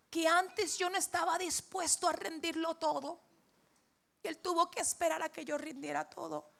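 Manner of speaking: high, loud, emotional retelling of dad; imitated anguished confession. Father confesses he had not surrendered everything, imitated anguish.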